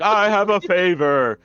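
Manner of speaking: high, slow put-on lady voice; wacky lady. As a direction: mimic the lady, high and drawn out. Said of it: "And she's like".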